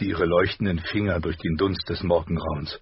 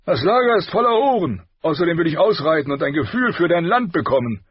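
loud, raised, impatient royal voice; boisterous king; 10 semitones higher, 6 dB louder than usual. A narrator's reading. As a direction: declare it loudly as a blustering king. (polternder König, laut und ungeduldig)

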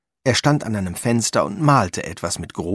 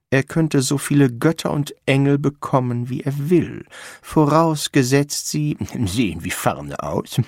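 higher, deliberate, stubborn artist; haughty Ferdinand Frost; 6 semitones higher than usual. Acted. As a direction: insist loftily, stretching the condition. Frost insisting on ferns.

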